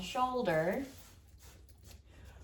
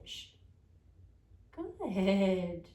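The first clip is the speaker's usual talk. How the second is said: ordinary delivery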